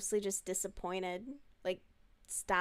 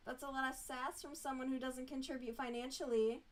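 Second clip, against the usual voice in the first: mock scolding; 4 semitones higher, 7 dB quieter than usual. Scolding the dog.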